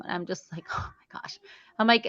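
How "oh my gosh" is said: breathless whispered oh my gosh; under-the-breath dismay; whispered exasperation. Retold or imitated. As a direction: whisper it in disbelief. Exasperated reaction to mom.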